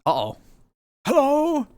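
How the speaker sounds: high anxious hello into the mic; calling out, afraid no one hears; sudden alarm at the mic; worried mic check. Real, not acted. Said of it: "I can't hear you".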